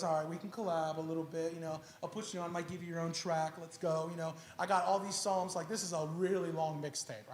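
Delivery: low-key recruiting a collaborator; laid-back pitch. Acted. David bringing Asaph onto the track, laid-back and low.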